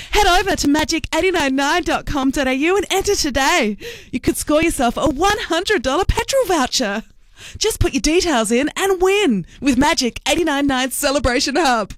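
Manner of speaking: radio voice. Shown as a demonstration. Host showing off the radio voice to listeners, radio voice. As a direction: put on the big promo voice. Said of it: "want me to put my radio voice on".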